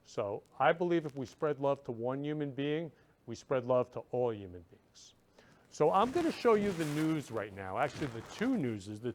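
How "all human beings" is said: breathy whisper, almost no voice. Real, not acted. Host whispering his love message to viewers. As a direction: let the last words fall to a whisper. Closing thought on spreading love.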